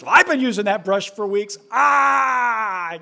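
high, loud fake sobbing kid, fast; mock crying wail. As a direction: wail it like a child in a tantrum. Kids quarrel voiced.

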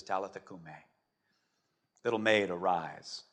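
low, soft waking words; tender command. Acted. Jesus taking the dead girl by the hand, tender. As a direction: wake a child gently.

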